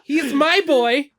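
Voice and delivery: hammed-up possessive pride over a creation; doting creator. Game creator showing off a digital boy, hammed-up doting.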